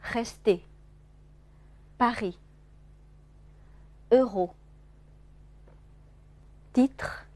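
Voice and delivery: French words read one by one, each well separated; French R demonstration. Shown as a demonstration. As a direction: model each word carefully for learners. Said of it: "大家体会一下啊". (法语r发音示范朗读)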